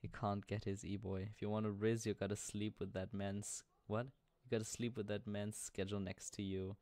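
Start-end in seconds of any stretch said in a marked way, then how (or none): none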